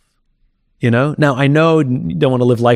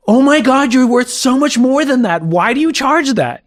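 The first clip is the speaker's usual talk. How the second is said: much higher, effusive, slowing on the question; gushing and supportive; 13 semitones higher than usual. Shown as a demonstration. How normal people ask a friend about prices, warm and gushing. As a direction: gush with admiration before asking gently. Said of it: "normal people would have said".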